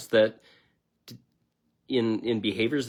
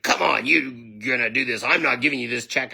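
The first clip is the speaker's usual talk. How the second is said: pissy manager; 4 dB louder than usual. Retold or imitated. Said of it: "he got all pissy and he got angry".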